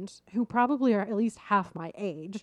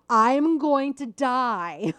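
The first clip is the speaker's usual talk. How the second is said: slow, higher, louder mock scream; retold scream; 5 semitones higher, 6 dB louder, much slower than usual. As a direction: wail it like you're dropping down the hill. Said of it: "I screamed".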